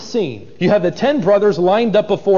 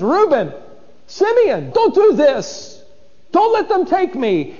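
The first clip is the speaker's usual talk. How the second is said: much higher, slow, a cry for help; desperate pleading; 10 semitones higher than usual. Acted. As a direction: plead with the brothers not to let them. Joseph pleads from chain gang.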